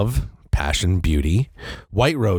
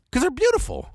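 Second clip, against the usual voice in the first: high, swooning beautiful; dreamy admiration; faster than usual. Retold or imitated. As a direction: swoon over the roses. Imitating self admiring roses.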